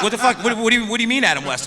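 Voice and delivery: high, loud baffled outburst; bewildered actor, voice shooting up. Retold or imitated. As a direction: react like you can't believe the question.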